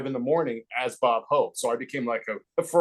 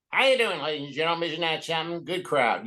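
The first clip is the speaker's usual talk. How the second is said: lightly voiced Bob Hope, smooth showman; Bob Hope impression. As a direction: greet the crowd like an old-time host. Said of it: "it would be like".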